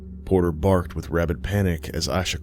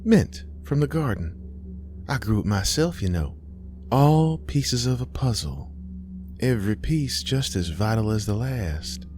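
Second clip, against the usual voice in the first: higher-pitched, musing motherly voice; mother in a vision; 5 semitones higher than usual. Acted. Mama talks of puzzle pieces to Aisha, musing. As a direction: muse aloud like a cryptic mama.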